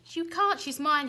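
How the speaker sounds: high, loud dragon roar; angry roar at the knight. A narrator's reading. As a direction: fight for your prize. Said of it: "he roared".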